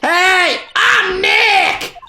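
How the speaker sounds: high, full-force shouted introduction. Acted. Nick retries the camera mic, loud high shout. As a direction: yell it to set off the microphone. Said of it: "not saying it loud enough".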